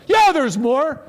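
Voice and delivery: high, loud mock yell; imitating a yell. Angry cab driver yelling back at his passenger, loud mock yell. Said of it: "And he yelled some more".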